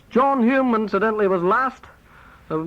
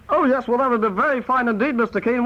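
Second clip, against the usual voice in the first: ordinary talk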